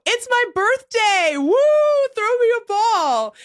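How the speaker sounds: high, slow pleading fan call; re-enacted excited holler, stretched out. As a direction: whoop and beg across the field. Pleading with player from the stands.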